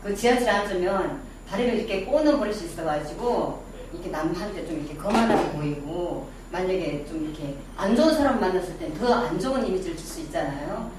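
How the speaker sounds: ordinary talk, nothing marked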